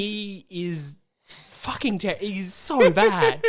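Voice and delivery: slow drawn-out laughing scorn. Real, not acted. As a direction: drag it out laughing. Arguing about Pegasus.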